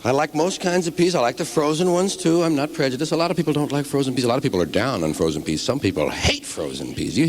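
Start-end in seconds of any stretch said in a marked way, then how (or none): none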